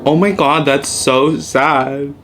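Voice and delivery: loud, drawn-out mock sorrow; faked sympathy. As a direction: feign sadness to hide the urge to laugh. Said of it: "I'm gonna laugh".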